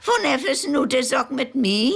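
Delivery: high, sly grandmother voice ending in a laugh; a cheeky old woman delivering the punchline; clever grandmother. Acted. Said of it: "sagt sie klug".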